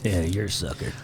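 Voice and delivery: low, soft presidential voice; deep, quiet mock-president; president impression. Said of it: "It's my best president impression".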